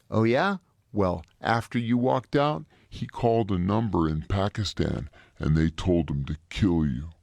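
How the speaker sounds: low, slow menacing officer voice; lieutenant delivering a threat, deep and slow; gruff lieutenant. Retold or imitated. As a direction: drop low and slow like a hard-nosed officer.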